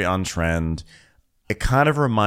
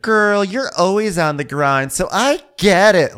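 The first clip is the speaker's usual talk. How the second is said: higher, louder, slow gushing; mock gushing mom; 12 semitones higher, 7 dB louder, slower than usual. Victoria to busy guest, high loud gushing. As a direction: purr it like a doting fan. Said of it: "Victoria's like".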